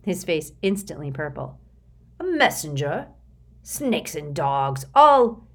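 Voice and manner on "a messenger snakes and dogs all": sputtering, then a loud outburst cut off; a boss about to explode with rage; furious executive cutting himself off mid-curse; rising fury. Acted. Curtin erupts in fury.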